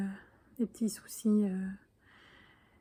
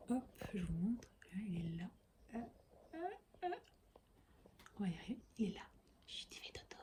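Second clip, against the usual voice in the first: whispering over a crib; 15 dB quieter, faster than usual. Real, not acted. Showing knitting while baby sleeps.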